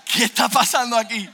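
high, loud exclamation; loud reenacted amazement; astonished outburst. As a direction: blurt it out in amazed bewilderment. Acts stunned reaction, second time.

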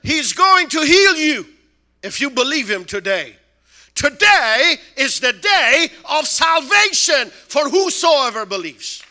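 loud, high preacher's call; preaching at full force. Retold or imitated. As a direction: preach it to the whole hall. Preaching healing to crowd.

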